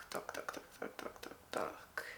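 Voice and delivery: whispering dark over and over; whispered repetition. Real, not acted. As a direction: murmur it while searching. Muttering while choosing color.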